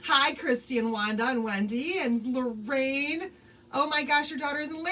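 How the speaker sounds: ordinary talk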